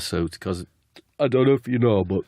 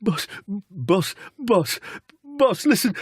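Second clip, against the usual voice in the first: very high, halting plea; hammed-up strained begging; Jack; 11 semitones higher, slower than usual. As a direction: squeak out each word with gaps. Desperate player pleading with manager.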